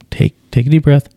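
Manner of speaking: usual speaking voice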